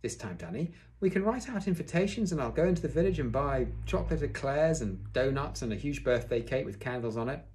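high, eager build-up easing off; excited proposal. A narrator's reading. Father proposing a birthday party to Danny, excited. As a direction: sell the party with lifted pitch.

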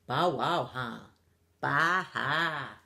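low dog and sheep laughs; deep-pitched farm animals jeering; mocking animal laughter. A narrator's reading. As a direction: drop low for barking, bleating ha-has. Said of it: "the other animals on the farm laughed".

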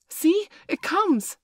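high, slow, thrilled call; boy cries out as the bird appears; excited cry. A narrator's reading. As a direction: point and shout in wonder. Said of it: "cried Curly".

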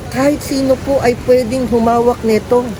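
usual speaking voice

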